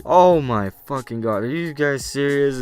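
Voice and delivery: exasperated, can't believe it; disbelief. Retold or imitated. To friends setting up the prank, loud disbelief.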